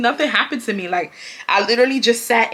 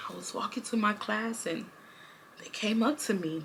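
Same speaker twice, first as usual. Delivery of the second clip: pouty, much softer victim voice; playing the victim; 15 dB quieter than usual. Student telling the office she was the victim, soft pouty voice. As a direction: sound small and wronged. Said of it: "I was pouted and everything".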